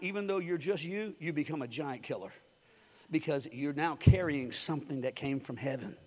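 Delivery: usual speaking voice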